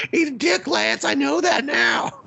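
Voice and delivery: very high mock shout; hammed-up shrieking realization; mock shrill outburst. Friend mocking the daughter's realization, shrieking very high.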